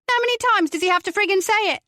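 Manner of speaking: high, loud angry snap; snapped. A narrator's reading. Mother snapping at the detective questioning her son. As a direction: bite her head off.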